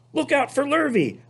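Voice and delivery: high warning cow call; high urgent cow warning. Acted. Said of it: "called the cows".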